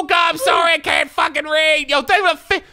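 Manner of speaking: high, loud frantic yelling; hyped streamer scream; excited shout. Real, not acted. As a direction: shout the apology and thanks. Screaming apology and thanks.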